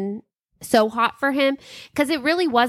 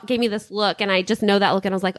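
usual speaking voice

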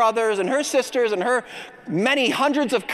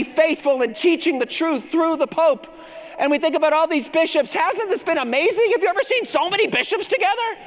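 higher, building toward the cheers; rousing preaching; 6 semitones higher than usual. Acted. Preacher rallies the congregation to cheer, rousing. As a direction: whip up the crowd with fervour.

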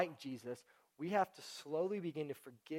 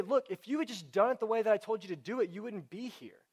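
higher, louder exasperated telling-off; mock scolding; 5 semitones higher, 6 dB louder than usual. God answering a sinner who asks forgiveness, mock scolding. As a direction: lay down the law, acting it out.

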